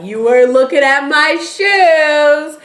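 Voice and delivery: high, loud, slow teasing accusation; mock indignant Zoe. Zoe teasing Miles about staring, loud and high. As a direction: drag it out, teasing him. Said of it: "she's like".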